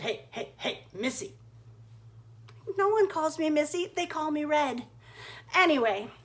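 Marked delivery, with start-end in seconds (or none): 0.0-1.3 low, hushed wolf calling out with a sly chuckle; creepy wolf. Acted. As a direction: lure her in, quiet and sly. Wolf calls out from the woods.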